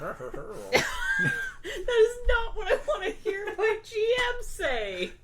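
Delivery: high, slow laughing wail; half-laughing, half-crying complaint; laughing whine. Real, not acted. Player's mock despair at GM.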